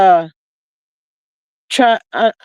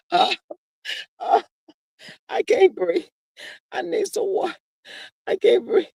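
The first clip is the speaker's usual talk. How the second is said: mock fainting gasps; 7 semitones higher, 6 dB quieter than usual. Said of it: "I be like".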